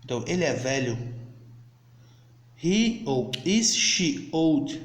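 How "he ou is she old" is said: slow, carefully spaced model question; slow demo English. Shown as a demonstration. Teacher to students learning English, slow and deliberate. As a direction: spell it out slowly for beginners. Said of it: "Então, nós podemos falar".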